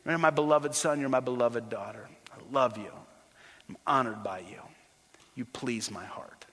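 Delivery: soft, low fatherly affection; hushed, heartfelt blessing from a father; tender voice of God. Acted. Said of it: "he would say the same of you".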